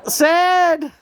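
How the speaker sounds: high, loud joyful shout of a name; excited call. Real, not acted. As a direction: call him in with delight. Calling out to a spirit.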